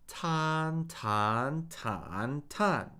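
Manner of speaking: cycle "tan" through flat, up, down-up, down; "tan" four times, each with the next Mandarin tone; four-tone drill. Shown as a demonstration. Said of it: "So repeat after me".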